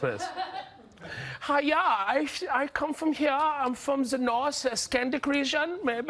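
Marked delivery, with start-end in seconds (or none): 1.4-6.1 high, slow put-on Swedish accent. Comedian giving hints about his flag, mock Swedish accent. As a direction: play a mock Swede, pitched well up. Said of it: "yeah, Sweden".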